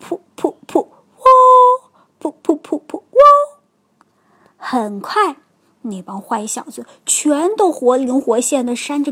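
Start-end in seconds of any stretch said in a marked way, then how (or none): 0.0-3.0 puffed clucks, then a crowed whoop; rhythmic play-chicken noises; mock chicken clucking. A narrator's reading. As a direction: imitate a chicken to taunt. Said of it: "还咯咯咯地叫着".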